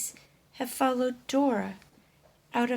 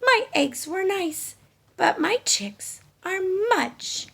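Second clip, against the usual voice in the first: happy; 9 semitones higher, 6 dB louder than usual. Acted. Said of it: "said Dora happily".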